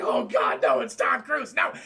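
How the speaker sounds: loud, panicked cry of recognition; mock panic. Acting out panic at Tom Cruise.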